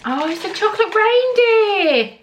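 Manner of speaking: high, slow, thrilled exclamation; delighted squeal. Real, not acted. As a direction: savor the find with a squeal. Unboxing gift, delighted squeal.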